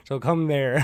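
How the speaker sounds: a joking line with a chuckle; laughing. Real, not acted. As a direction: say it through a real laugh. Laughing while joking about relapse.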